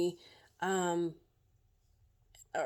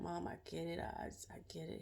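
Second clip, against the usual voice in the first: son's gentle reassurance; 13 dB quieter, faster than usual. Retold or imitated. Son quoted consoling her.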